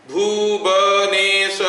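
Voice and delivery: Bhubaneswar drawn out slowly in five separate sounds; syllable by syllable. Shown as a demonstration. Teacher showing five sounds to students, Bhubaneswar syllable by syllable. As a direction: say Bhubaneswar slowly, each syllable held apart. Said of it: "I am creating five sounds".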